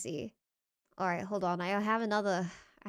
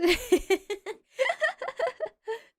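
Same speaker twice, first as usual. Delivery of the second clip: high-pitched drawn-out laughing fit; real laugh; 11 semitones higher than usual. Real, not acted. Amused by the word cultivated.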